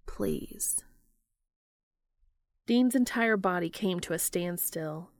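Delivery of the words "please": whispered, breathy please; soft plea. A narrator's reading. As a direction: plead in a whisper. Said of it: "his voice softening".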